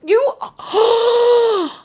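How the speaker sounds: sharp high gasp, then oh; a startled gasp and cry; real gasp of surprise. Real, not acted. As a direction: caught off guard, gasping. Shocked by viewer's observation.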